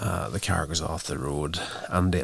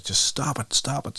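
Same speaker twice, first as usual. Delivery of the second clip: mock scream; 7 semitones higher than usual. Doctor pleading down the phone as the movie cuts out, mock scream. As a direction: mimic screaming with raised pitch, volume held back. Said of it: "screaming at the top of his lungs".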